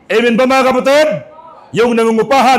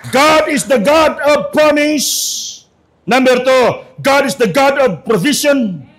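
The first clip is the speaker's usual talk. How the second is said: preaching at full force; 4 semitones higher, slower than usual. Acted. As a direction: preach each point slowly and high for the church. Preaching the three points. (malakas at mabagal na pangangaral)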